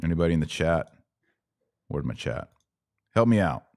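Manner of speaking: normal talking voice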